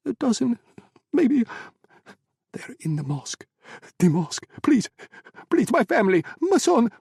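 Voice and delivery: high, loud terrified begging; desperate pleading. Acted. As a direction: beg for his son's life. Owner begs for family.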